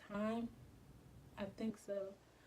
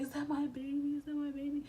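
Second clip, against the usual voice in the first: retold panic; 5 semitones higher than usual. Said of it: "I was panicking already".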